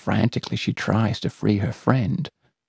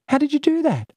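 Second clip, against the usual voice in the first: put-on very high astonished child; amazed girl; 3 dB louder, faster than usual. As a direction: gape at the impossible. Celia amazed.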